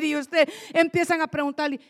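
ordinary talk, nothing marked

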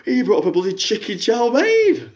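a lightly put-on middle-aged fan on the phone; a fan phoning in with a sob story, lightly voiced; Jackie caller voice. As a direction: play the hard-up caller, chatty and woeful.

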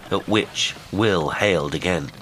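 ordinary delivery

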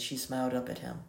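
ordinary delivery

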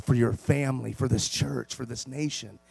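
hushed pastoral appeal. Acted. Pastor pleading softly with his flock to get close to God. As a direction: draw the congregation close, gently.

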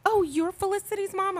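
high, bright sugary greeting; sweet teacher voice. Retold or imitated.

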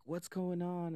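lightly acted alarm; mock bewilderment. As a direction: imitate puzzled onlookers. Retold bystanders' puzzled question.

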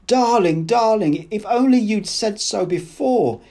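high, loud, rapturous outpouring; joyful love. A narrator's reading. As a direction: cry it out to a beloved. Woman's joyful declaration of love.

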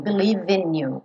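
believe in linked as "believin", V joined to in; "believe in you" linked. Shown as a demonstration. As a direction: run the V of believe into in. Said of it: "vou ligar no I do in".

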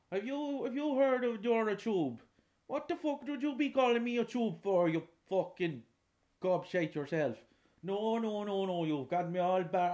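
high, loud Irish farmer who misheard; indignant old countryman. Acted. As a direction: bark it back like a cranky old farmer. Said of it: "imagine the conversation that would have to happen".

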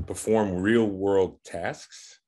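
"perform real world tasks" slow, each word stressed; over enunciating. Shown as a demonstration. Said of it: "two key words in that statement".

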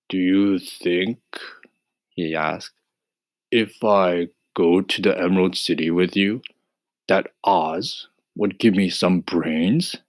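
husky scarecrow. Acted. Voices the scarecrow.

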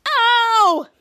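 loud, high "ow"; acted cry of pain; pained yelp. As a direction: yelp as if hurt, hammed-up. Character bites a rock.

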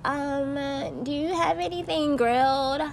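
high, prissy imitation of a clueless customer; lightly sneering customer impression. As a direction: put on a mocking high voice.

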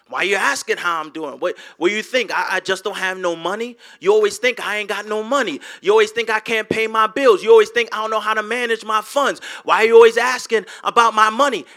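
loud, fast, offended outburst; defensive money rant. Retold or imitated. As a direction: fire back as if accused of being broke. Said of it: "and you say".

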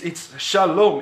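ordinary delivery

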